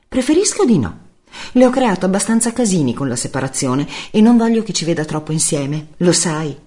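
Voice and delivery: high-pitched woman on the phone, firm refusal; Giulia seccata. Acted. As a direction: voice a tense ex-partner, pitched well up. Voce Giulia al telefono. (voce acuta di donna seccata)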